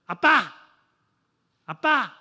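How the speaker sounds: put-on loud, high child's call of "아빠! 아빠!"; a child calling dad at dawn. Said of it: "새벽에 애들이 저를 불러요". (새벽에 아빠를 부르는 아이, 크게 외치는 소리)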